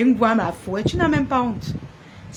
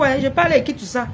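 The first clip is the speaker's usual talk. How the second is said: ordinary talk, nothing marked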